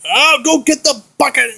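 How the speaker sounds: ordinary talk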